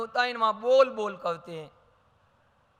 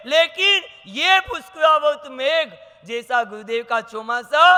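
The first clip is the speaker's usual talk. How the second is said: higher, louder impassioned sermon; fervent preaching; 5 semitones higher, 7 dB louder than usual. Real, not acted. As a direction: urge the crowd with rising fervour. Preaching at full force. (जोशीले प्रवचन का ऊँचा, तेज़ स्वर)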